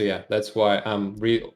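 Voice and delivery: ordinary talk, nothing marked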